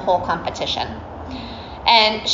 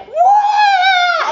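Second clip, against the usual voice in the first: audience shout; 5 dB louder than usual. Retold or imitated. Audience reacts in disbelief.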